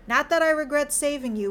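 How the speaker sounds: quickly added reassurance. A narrator's reading. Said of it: "William quickly added".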